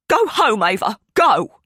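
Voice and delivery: loud, sharp command; shouting orders. Acted. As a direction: send her daughter away. Mother orders Ava out.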